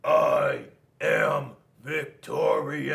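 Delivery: loud, very slow triumph; victory yell. A narrator's reading. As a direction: stretch out the victory boast. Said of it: "And Rock yelled".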